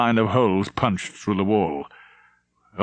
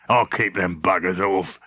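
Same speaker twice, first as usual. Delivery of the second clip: gruff old Shiner, lightly voiced; old Shiner snorting defiance, lightly voiced; snorting old man; 3 semitones lower than usual. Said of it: "and snorted".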